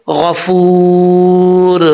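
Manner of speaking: गफूर very slow, oo held long; long madd. Shown as a demonstration. Teacher showing the vowel length, drawn-out recitation. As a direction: stretch the oo of गफूर into a closing r. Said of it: "एक अलिफ की मिकदार लंबा करेंगे".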